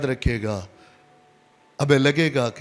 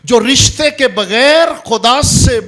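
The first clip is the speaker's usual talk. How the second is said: loud, high-pitched pulpit delivery; full-force preaching; 10 semitones higher, 11 dB louder than usual. Real, not acted. Preacher warns about love without relationship, loud and forceful. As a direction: hammer the point home from the pulpit.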